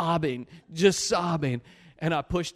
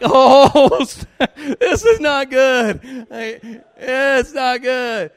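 preacher reliving the wait for a slap, high laughing dread; loud, high whimper of mock panic; comic dread; 7 semitones higher, 11 dB louder, much slower than usual. Bracing for Caleb's slap on stage, comically panicked. Acting dread before being slapped.

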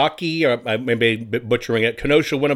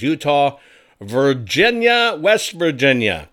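ordinary delivery

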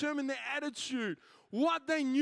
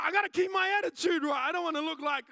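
mock stubbornness; 5 semitones higher, 6 dB louder, faster than usual. Driver's stubborn inner voice, acted.

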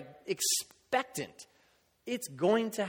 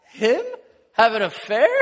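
loud, higher incredulous retelling; loud mock-astonished question; mock laughing disbelief; 5 semitones higher, 12 dB louder than usual. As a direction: imitate a woman amused by the very idea. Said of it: "this one woman just started laughing".

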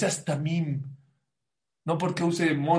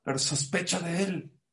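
ordinary delivery

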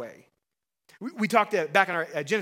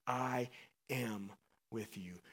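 hushed promise; 6 semitones lower, 11 dB quieter, slower than usual. Acted. God's promise, fading slow.

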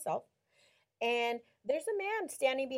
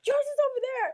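much higher, louder mock insistence; high, loud retold pointer; 12 semitones higher, 6 dB louder, faster than usual. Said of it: "And I said".

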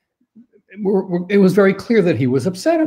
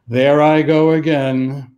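slow, mock-exasperated admission; acted "oops, me again"; wry self-reproach; 4 dB louder, slower than usual. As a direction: own up to it lightly and slowly. Models wry owning of outburst.

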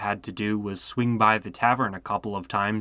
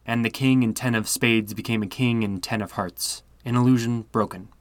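usual speaking voice

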